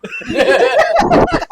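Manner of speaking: a high, loud burst of laughter; loud laughing fit. Real, not acted. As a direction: crack up at the memory. Laughing at egg's face.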